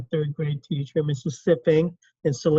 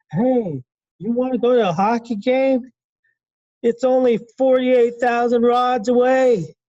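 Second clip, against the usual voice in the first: imagined friend; 6 semitones higher, 4 dB louder than usual. Retold or imitated. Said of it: "what if someone said to me".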